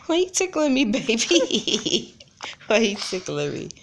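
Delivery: high squealing laughter; being tickled. Real, not acted. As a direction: laugh while getting tickled. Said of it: "She's tickling me".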